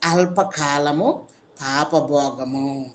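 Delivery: lent et grave, beaucoup plus bas; détacher chaque mot, lentement et gravement; lecture appuyée. Shown as a demonstration. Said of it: "ఏమంటాడు చూడండి".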